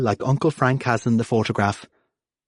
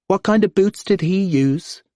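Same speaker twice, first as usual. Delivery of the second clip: high, hurried blurt; blurted question; 9 semitones higher than usual. Acted. P J blurts a question at Grandad, high and hurried. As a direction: let it tumble out too fast. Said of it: "he blurts".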